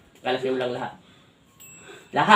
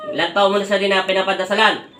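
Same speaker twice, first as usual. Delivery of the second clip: louder, higher demanding question; stern interrogation; 5 semitones higher, 5 dB louder than usual. Real, not acted. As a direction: press hard for an answer. Healer interrogates the possessing party.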